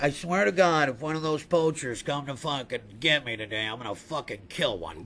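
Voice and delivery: very low, hushed brooding elephant; Jumbo. Acted. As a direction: sink deep and quiet, a beast vowing revenge. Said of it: "I imagine that's how he sounds".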